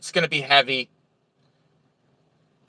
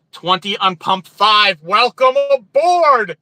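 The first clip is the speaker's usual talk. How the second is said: drawn-out, rising, loud hail; rousing welcome; 6 semitones higher, 4 dB louder, slower than usual. Real, not acted. Streamer hailing a new viewer, rousing drawn-out welcome. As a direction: build to a big, stretched, higher-pitched cheer.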